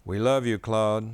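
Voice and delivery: lightly voiced family, loving. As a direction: tell the dog he is loved.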